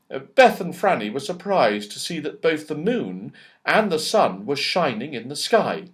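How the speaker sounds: ordinary delivery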